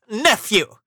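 high, loud stern address; firm reply. A narrator's reading. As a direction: cut the nephew off firmly. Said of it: "the uncle replied firmly".

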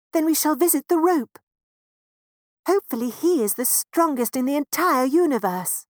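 hope this one is the strongest; a lightly voiced, hopeful mouse parent. Parents decide on the rope.

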